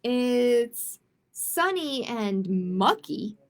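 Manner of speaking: ordinary delivery, nothing marked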